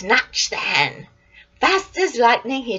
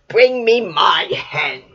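louder, slow demand; giant's order; 2 semitones lower, 7 dB louder, slower than usual. Acted. Giant demanding his hen from his wife, loud and slow.

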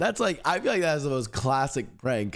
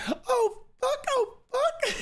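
much higher falsetto moaning with a laugh; imitated porn moan; slower than usual. Porn audio blasting through the house, imitated in a high falsetto moan. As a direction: mimic the video's moaning, squeaky and breathy. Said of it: "you just hear it throughout our house".

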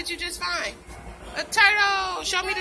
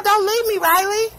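voicing the turtle, loud and pleading; 2 semitones higher, 12 dB louder than usual. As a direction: plead loudly as the little turtle. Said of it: "He said".

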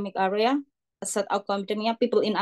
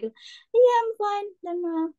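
high, bright polite reply; demo reply; 8 semitones higher than usual. Shown as a demonstration. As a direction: answer cheerfully, pitched well up. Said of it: "but you say, like".

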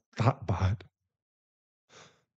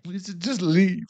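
pleading; 8 semitones higher, slower than usual. Acted. Begging partner to leave him.

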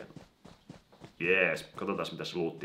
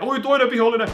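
much higher, loud alert; excited alarm; 14 dB louder than usual. Real, not acted. Spotting another enemy, excited alarm. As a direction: shout out the new threat.

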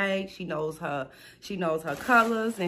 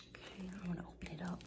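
quick excited whisper; breathless little whisper to herself; 16 dB quieter, faster than usual. Real, not acted. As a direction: hush it like a secret. Excited unboxing, hushed aside.

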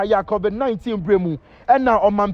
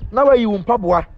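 usual speaking voice

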